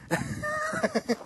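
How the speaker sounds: high delighted laugh. Real, not acted. Showing off her new surgery, a high giddy laugh.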